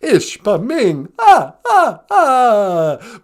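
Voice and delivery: put-on loud, theatrical vampire count with an accent; Count Von Count. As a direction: ham up the counting vampire, rolling "ah, ah". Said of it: "the Count".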